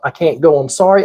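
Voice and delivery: ordinary talk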